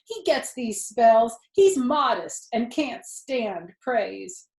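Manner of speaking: loud, slow flustered explaining; loud flustered excuse. Acted. Zuckerman making loud excuses to the crowd, flustered. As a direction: cover for the fainted pig.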